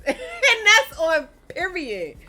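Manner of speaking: loud laughing slow declaration. Real, not acted. Cracking up at her sister's rant, loud and slow. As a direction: laugh it out while stating it.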